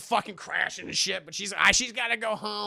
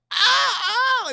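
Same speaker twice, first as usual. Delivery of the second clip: high, loud imitated agony cry; mock pain scream; 9 dB louder than usual. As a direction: cry out as if stabbed, hammed up. Imitates stabbed father's cry.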